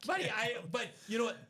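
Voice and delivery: laughing while telling. Real, not acted. Laughing while retelling the story.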